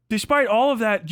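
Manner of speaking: ordinary talk, nothing marked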